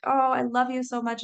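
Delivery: shower the dog with love; cooing at the dog. Retold or imitated. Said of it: "I'm petting him and I'm like".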